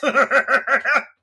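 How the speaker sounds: risa estallando, muy alta y fuerte; carcajada aguda y fuerte. Real, not acted. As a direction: suelta la carcajada tras el chiste. Laughs at own punchline.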